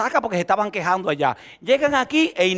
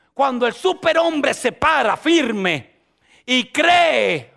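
ordinary talk, nothing marked

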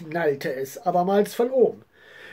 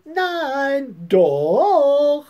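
put-on high childish squabble, last word louder; bickering kids; 5 semitones higher, 5 dB louder than usual. A narrator's reading. Siblings bickering.

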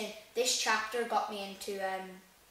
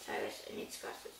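breathy aside under the breath; whispered; 7 dB quieter than usual. Acted. Unvoiced line in reading.

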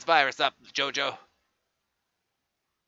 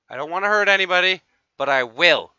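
loud, fast, hammed-up warning; yelled playful threat; mock threat; 5 semitones higher, 9 dB louder than usual. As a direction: bark it at the other player. Threatening other players in game.

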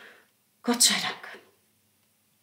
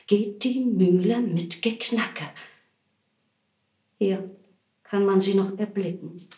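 ordinary delivery

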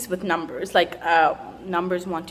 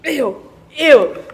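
disgusted ew; 5 semitones higher, 10 dB louder than usual. Real, not acted. Reacts to tasting food.

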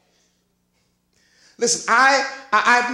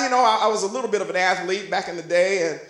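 ordinary talk, nothing marked

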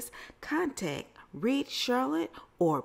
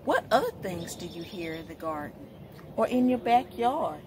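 put-on reading to children; 3 semitones higher than usual. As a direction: read it like story time on the rug. Reads picture book to children.

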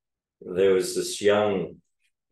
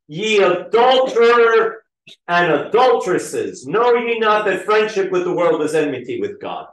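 preaching scripture; 8 semitones higher, 5 dB louder than usual. Acted. Preacher reading James to the congregation, fervent and loud.

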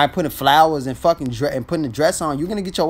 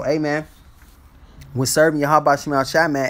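normal talking voice

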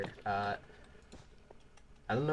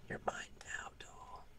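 creepy whisper; 17 dB quieter than usual. Acted. Creepy line to the captured animatronic.

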